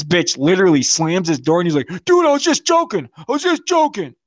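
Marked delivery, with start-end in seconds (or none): 2.1-4.1 high-pitched mock pleading; much higher, frantic imitation; panicked backpedalling. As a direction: imitate a coward squealing behind a door. Acting out the backpedalling friend.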